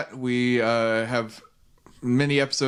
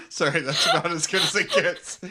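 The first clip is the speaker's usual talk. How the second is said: laughing apology, pitched up; 8 semitones higher than usual. Real, not acted. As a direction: apologize through your own laughter. Laughing after own bad impression.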